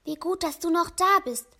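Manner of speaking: ordinary delivery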